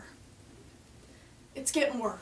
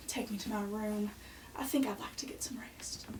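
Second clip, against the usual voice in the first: whispered, tired and fading; barely enough breath left; weak whisper; 4 semitones lower, 8 dB quieter than usual. Acted. Sick mother whispering to her sons.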